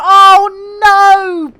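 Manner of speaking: loud, high wail of dismay; big, drawn-out groan of sympathy; dismayed cry. Real, not acted. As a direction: cry out at terrible news. Dismay at viewer's computer trouble.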